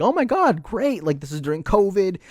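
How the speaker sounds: high, mocking "oh my God, great"; sarcastic fake excitement; mock delight. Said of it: "you're just like".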